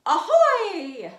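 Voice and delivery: loud high pirate call; pirate ahoy. Shown as a demonstration. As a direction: shout it like a pirate. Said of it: "let's do ahoy also".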